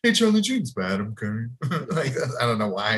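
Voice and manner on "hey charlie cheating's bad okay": mock-lecture the boy in character, trailing down; Mister Mac. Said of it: "like, Mister Mac".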